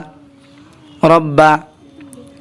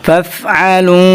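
ordinary delivery

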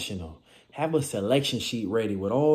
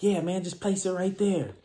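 laid-back worker; 6 semitones higher than usual. Retold or imitated. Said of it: "they're just like".

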